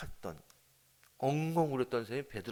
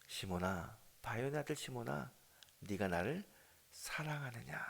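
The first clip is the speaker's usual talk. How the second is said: soft, quiet, ending in a whisper; tender question; 7 dB quieter than usual. Acted. Jesus asking Peter softly whether he loves him. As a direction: ask it gently, almost breathing it.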